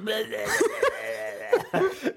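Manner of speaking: high, wordless crazed babble; hammed-up high gibberish; mock mother noise. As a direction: jabber like a possessed mom. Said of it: "the mother's just like".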